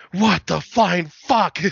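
slow, loud, stretched-out disbelief; mock shocked outburst. Brother hearing the ghost voice again, slow stunned outburst. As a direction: drag each word out in mock horror. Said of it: "And he's like".

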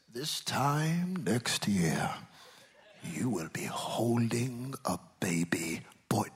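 slow, breathy whispered prophecy; hushed whisper. Shown as a demonstration. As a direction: whisper the promise slowly. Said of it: "look at what he says".

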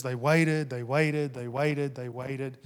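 they waited, fading to a hush; fading repetition. Acted. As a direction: let waited die away step by step. Repeated waiting, fading each time.

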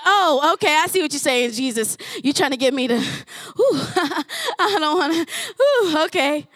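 hammed-up squirming at God's word; reluctant realization.

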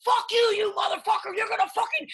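high, loud furious yell back; angry shout. Retold or imitated. Chef yelling back at a huge guy in the kitchen, furious shout. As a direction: snap back hard at a bigger man. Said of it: "and I'm just like".

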